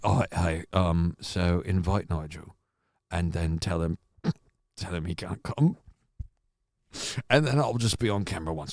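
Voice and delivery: a sly, low, quiet Boris Johnson scheming aloud; mock Boris Johnson plotting, low and hushed; Boris Johnson impression. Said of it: "Let me do my Boris Johnson impersonation quick".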